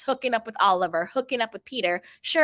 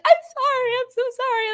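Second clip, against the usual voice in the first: much higher and louder, a squeaky rushed sorry; mock apologetic squeal; 5 dB louder, faster than usual. Driver apologizing to a pedestrian she cut off, in a high squeaky mock-sorry voice. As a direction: squeak out an over-the-top apology. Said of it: "I'm always like".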